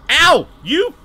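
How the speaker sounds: sudden high, loud yelp of pain. Real, not acted. Gamer hit by a zombie, yelping loud and high. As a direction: yelp at whoever just hit you.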